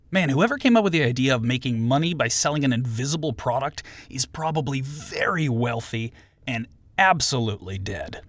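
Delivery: usual speaking voice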